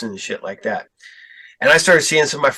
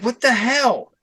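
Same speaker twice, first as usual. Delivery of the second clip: high, incredulous mock outrage; retold disbelief; 9 semitones higher, slower than usual. Retelling disbelief at rumor.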